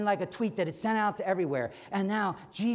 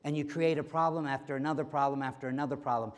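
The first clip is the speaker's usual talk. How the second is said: usual speaking voice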